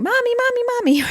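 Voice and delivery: put-on high-pitched little kid calling for mom; toddler begging. Toddler tugging on mom's pant leg, high pleading mimicry. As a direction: imitate a toddler pestering a parent. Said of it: "little toddler pulling on my pant leg going".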